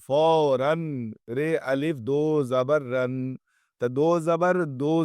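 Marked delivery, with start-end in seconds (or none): 0.1-1.1 فوراً said very slowly ending in a clear n sound; teacher stretching فوراً so the n rings; nunation shown. Shown as a demonstration. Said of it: "نون کی آواز پیدا ہوگی". 1.4-3.3 letter by letter, slow; slow classroom spelling; spelled out slowly. Shown as a demonstration. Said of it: "نون کی آواز پیدا ہوگی".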